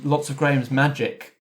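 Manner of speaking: ordinary talk, nothing marked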